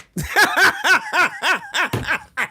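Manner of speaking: high rapid-fire cackle; long staccato laugh, rising high; real laughter. Real, not acted. Streamer cackles at his rival, high staccato laughter. Laughing at the man being roasted.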